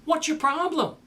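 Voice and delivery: high, blunt challenge; mock exasperated. Jesus to the panicked disciples, mock exasperated. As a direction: toss it off with disbelief. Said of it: "he goes".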